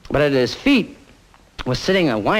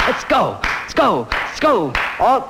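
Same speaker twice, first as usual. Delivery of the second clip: high, punchy repeated send-off; high-pitched rousing chant; rallying call; 8 semitones higher, slower than usual. Acted. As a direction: call the gang onward, pitch jumping up. Rousing the gang to set off.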